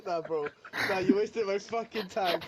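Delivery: ordinary talk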